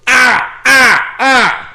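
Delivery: loud, high yelps of pain. Retold or imitated. Patient yelping as the nurse jabs a new spot, loud. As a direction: yelp at each jab. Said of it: "needle again".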